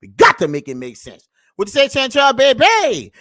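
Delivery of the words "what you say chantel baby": loud call to a friend; hey Chantel, called out loud. Real, not acted. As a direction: shout her name across the room. Calling out to a viewer.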